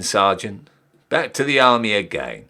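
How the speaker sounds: usual speaking voice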